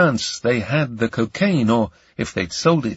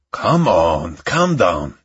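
lightly deepened man's voice; calm her down, dropping the pitch; soothing man; 5 semitones lower, slower than usual. Acted. Ion tries to calm crying Camellia, man's voice.

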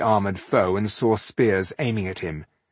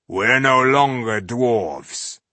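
slow ominous; 2 semitones higher, 5 dB louder, much slower than usual. Acted. Stranger revealing himself.

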